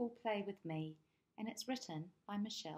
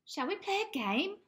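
put-on very high, loud child asking; little boy voice; 13 semitones higher, 9 dB louder than usual. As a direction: ask hopefully like a small boy. Little boy asks a frog.

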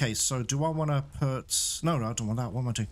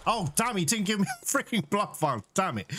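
higher-pitched, frustrated grumble; exasperated complaint; 6 semitones higher than usual. Real, not acted. Player denied a blood vial, exasperated. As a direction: complain as if cheated of your reward.

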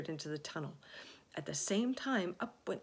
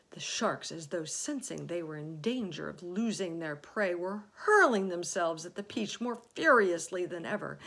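plain reading voice

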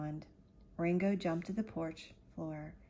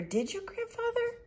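anxious plea; 4 dB louder than usual. Acted. Boy anxiously pleads.

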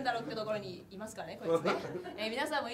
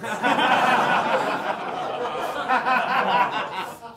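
long loud laugh; can't stop laughing; real laughing fit; 13 dB louder than usual. Real, not acted.

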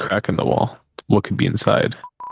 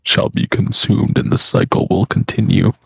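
ominous prophet voice. Pretend. Ominous moss cult chant.

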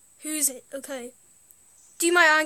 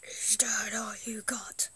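whispered taunt; 5 semitones lower, 8 dB quieter than usual. Pretend. Taunting as character.

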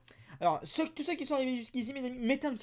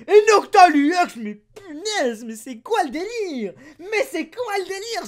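loud, slow, higher amazed cry; astonished; 7 semitones higher, 13 dB louder than usual. Real, not acted. Seeing a card he never saw, loud astonished cry. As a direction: react in disbelief at the card.

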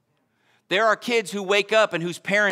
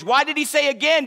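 normal talking voice

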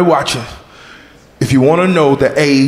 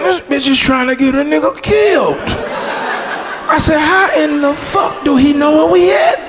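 higher, rattled panic; higher-pitched alarmed disbelief; panicked high voice; 10 semitones higher than usual. Retold or imitated. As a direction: sell the fear of getting killed. Panicked inner thought and question.

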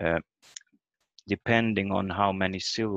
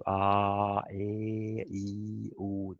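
open vowel demo; much slower than usual. Shown as a demonstration. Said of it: "You let the sound".

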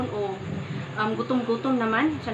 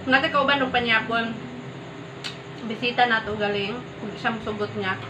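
at the table eating; possibly food in mouth; talking between bites. Real, not acted. Seen 0: spoon in hand, food before her; took bite at -5.

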